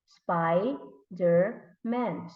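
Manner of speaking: very slow, split into SPI - DER - MAN; syllable by syllable. Shown as a demonstration. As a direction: break "Spider-Man" into three separate beats. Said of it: "if we're going to put it together".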